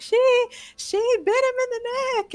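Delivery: put-on high child-like squeal of an accusation; Pearl. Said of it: "then Pearl's like".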